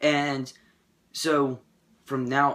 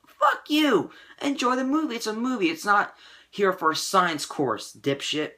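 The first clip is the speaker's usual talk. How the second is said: furious shout; 7 semitones higher than usual. Retold or imitated. The vlogger yells back at a nitpicking viewer, furious. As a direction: blow up at him, then cool down by "dipshit". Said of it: "and I'm like".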